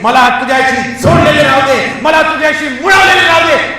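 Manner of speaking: mounting preacher's cry; fervent prayer. Acted. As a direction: plead harder with every phrase. Prayer builds to full force.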